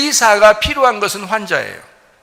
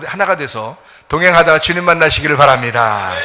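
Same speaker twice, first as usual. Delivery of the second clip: ordinary delivery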